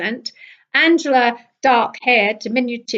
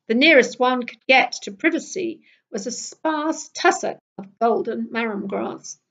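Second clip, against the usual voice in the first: plain reading voice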